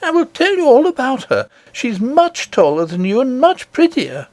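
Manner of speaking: put-on an eager child telling a wonder; little princess. Princess telling nurse of her grandmother, high and eager.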